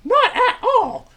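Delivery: very high, loud, drawn-out character voice; high-pitched puppet sidekick. Acted. Troy answers Milt's greeting in a very high sidekick voice. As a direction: squeak it out slowly and brightly.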